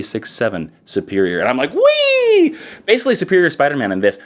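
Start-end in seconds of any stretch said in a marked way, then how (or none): 1.7-2.6 high, drawn-out squeal of glee; gleeful whee. Acted. Excited comic fan cheering his favorite series, gleeful squeal. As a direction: let out a high, sustained "whee" like a kid on a slide. Acting out delight at the book.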